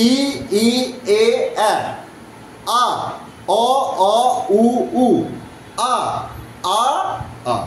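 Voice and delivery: steady chant from "ই" through "উ" to "আ"; teacher leading "ই, ই, এ, আ" and on, evenly spaced; twelve-vowel drill. Shown as a demonstration. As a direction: sound out each vowel from "ই" to "আ" separately. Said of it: "একসাথে যদি আবার বলি".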